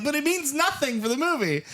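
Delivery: high, pleading objection; whiny protest; protesting crew. Retold or imitated. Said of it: "They're like".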